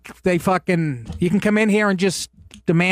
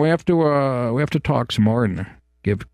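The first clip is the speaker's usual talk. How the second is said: imitating Obama; 6 semitones lower than usual. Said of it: "Obama would have been up there going".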